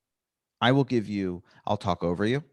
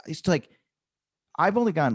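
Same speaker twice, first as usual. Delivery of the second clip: usual speaking voice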